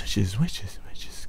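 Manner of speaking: whispered echo dying away into the cave; fading echo. Acted. Echo of a call into a cave, fading whisper. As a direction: let each repeat fade to a breathless whisper.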